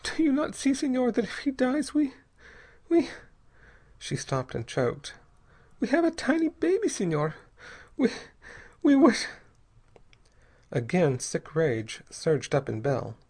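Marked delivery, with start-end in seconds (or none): 0.0-9.2 put-on high, pleading young woman's voice; frightened young mother. A narrator's reading. Young mother pleads with Bell, high and frightened. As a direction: voice her as a terrified wife begging.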